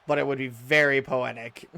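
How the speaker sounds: normal talking voice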